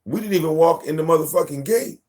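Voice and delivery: mild mock exasperation; exasperated. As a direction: act fed up with her. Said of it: "I'm like".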